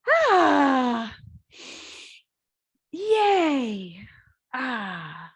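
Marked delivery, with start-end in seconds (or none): 0.1-4.0 big open ah into a high cheer; loud joyful cry. Shown as a demonstration. Teacher cheering with the class, loud and joyful. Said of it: "so make a sound".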